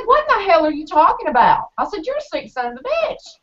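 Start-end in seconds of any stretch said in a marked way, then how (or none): 0.1-1.7 higher, louder retold outburst; retold angry confrontation. Retelling confronting the man. 2.0-3.4 much higher, scornful retold outburst; retold insult. Retelling insulting the man.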